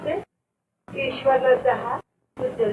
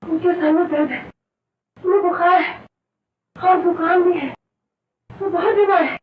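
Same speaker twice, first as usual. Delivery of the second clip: louder, raised, insistent explaining; urgent excuse; 4 semitones higher, 6 dB louder than usual. Acted. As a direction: press the excuse forcefully. Insistent excuse to keep child back. (ज़ोर देकर सफ़ाई देना)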